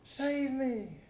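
high pleading cry for rescue; a sinking man begging for help; desperate plea. Acted. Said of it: "calling out".

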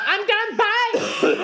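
high, breezy mock farewell; mock diva exit. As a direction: toss it off like a diva leaving.

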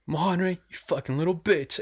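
high-pitched mocking insult; retold taunt. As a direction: imitate a dealer berating someone who refused. Dealer insulting Mao for refusing shot.

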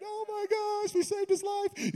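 very high squeal of a cheering crowd; mock cheering. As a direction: imitate onlookers squealing in relief. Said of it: "everybody's cheering".

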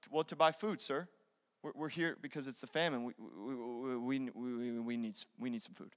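low, very soft, stammering plea; timid stammer. Acted. Brothers explaining the famine to Joseph, timidly stammering. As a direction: shrink back and stumble over the words.

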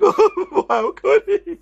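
high, laughing fake anguish; mock heartbroken. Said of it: "I wasn't like".

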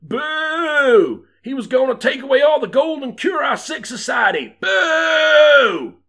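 high, loud crowd outrage; hammed-up angry jeering; bellowing townsfolk. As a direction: shout it as an indignant mob, pitched right up. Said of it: "bellowed the townsfolk".

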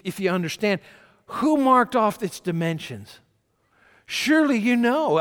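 rising from a firm challenge to a loud, high thunder; God's voice, building. Acted. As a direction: build God's questions until they boom. Preacher voicing God, building.

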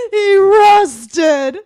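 gasping sob into a cry; tearful outburst. Acted. Lion mourns his rusted friend, sobbing. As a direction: choke up, then wail it.

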